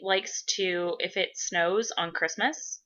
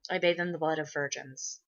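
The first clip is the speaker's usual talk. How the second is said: mock sinister; 2 semitones lower, 7 dB quieter than usual. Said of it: "No, I'm just kidding".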